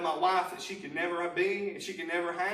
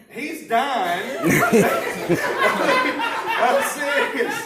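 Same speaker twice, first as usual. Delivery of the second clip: laughing disbelief; 8 semitones higher, 10 dB louder, much slower than usual. Real, not acted. Laughs about bearded dragon.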